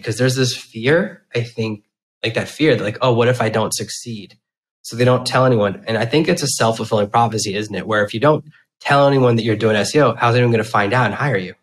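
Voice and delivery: ordinary talk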